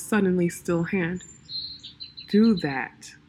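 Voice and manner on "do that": commanding. A narrator's reading. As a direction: give it as an order, calmly. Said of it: "he commanded".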